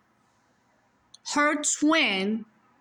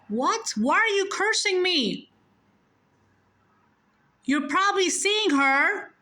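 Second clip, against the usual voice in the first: indignant confusion; 7 semitones higher than usual. Retold or imitated. Daughter confused by cursing dad.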